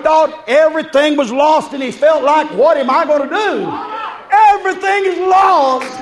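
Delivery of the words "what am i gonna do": ordinary talk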